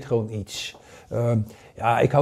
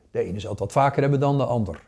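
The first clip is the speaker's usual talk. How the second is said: normal talking voice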